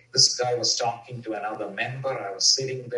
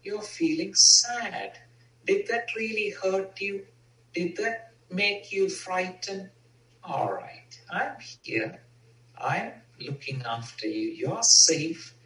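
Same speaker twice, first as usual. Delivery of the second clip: loving parent; 7 semitones higher than usual. Retold or imitated. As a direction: comfort a hurt child. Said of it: "bring my inner loving parent".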